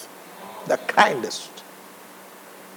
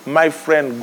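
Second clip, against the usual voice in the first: ordinary talk, nothing marked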